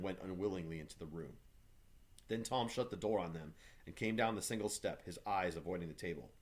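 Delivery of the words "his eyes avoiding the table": usual speaking voice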